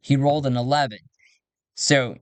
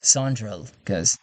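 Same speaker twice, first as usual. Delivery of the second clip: ordinary talk, nothing marked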